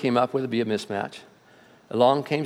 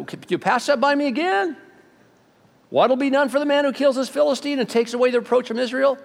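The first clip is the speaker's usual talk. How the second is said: eager question; 9 semitones higher than usual. Retold or imitated. David eagerly asking about reward.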